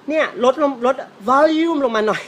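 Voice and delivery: affected request, pitched well up; affected English-mixing. Acted. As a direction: ham up volume with showy English pronunciation. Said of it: "ดัดจริตมากเลย".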